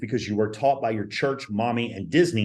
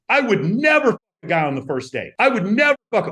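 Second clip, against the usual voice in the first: higher and louder, hammering never; mock emphatic insistence; 7 semitones higher, 5 dB louder than usual. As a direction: ham up her loud protest on "never". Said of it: "emphatically expressing to you".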